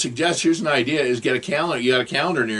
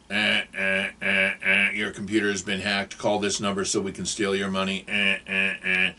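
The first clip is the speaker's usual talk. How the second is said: monotone buzzer and warning message; computer scam alert; 4 semitones lower than usual. Acted. Imitating a scam pop-up on the screen, monotone alert voice. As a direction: drone it like an automated computer alarm.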